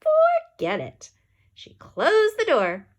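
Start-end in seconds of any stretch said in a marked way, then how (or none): 0.1-1.1 high, loud, slow forget it; curt refusal. A narrator's reading. Lady refusing the kids, high, loud and slow. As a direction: shut them down flat out.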